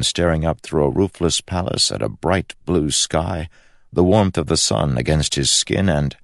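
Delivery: ordinary delivery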